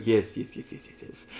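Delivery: fading into a rapid whisper; hushed creepy muttering; very quiet voice. Retold or imitated. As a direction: repeat it eerily, dropping away to almost nothing. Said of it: "in a very quiet voice".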